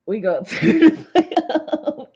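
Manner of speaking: loud, high burst of laughter; slow, loud, cracking up; laughing. Real, not acted. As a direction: laugh hard through the words.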